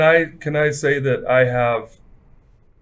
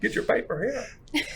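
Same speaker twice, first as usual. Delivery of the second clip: put-on high old-timey newsie call; 11 semitones higher, 8 dB quieter, faster than usual. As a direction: hawk papers like an old newsboy. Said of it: "That's an old-timey voice".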